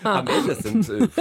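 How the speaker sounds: high, laughing answer; laughing while talking. Real, not acted. (lachend gesprochen)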